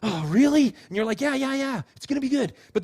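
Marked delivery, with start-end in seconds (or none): 0.0-0.7 eager little burst; excited child. Retold or imitated. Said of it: "they're all excited". 1.2-2.5 higher, upbeat parental reassurance; imitated encouraging parent; reassuring parent. Parent reassuring a nervous child.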